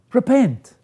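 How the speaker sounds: "Repent." high and loud, a ringing command; forceful repent. Acted. The Lord's call, repeated.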